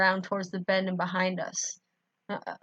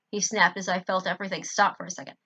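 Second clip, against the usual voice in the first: plain reading voice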